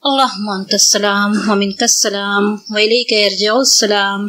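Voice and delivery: normal talking voice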